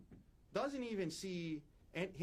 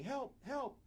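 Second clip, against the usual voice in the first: high-pitched mock yell, no real volume; retold yell; 9 semitones higher than usual. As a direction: imitate a cry for help, pitched up but held back. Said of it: "and she's yelling".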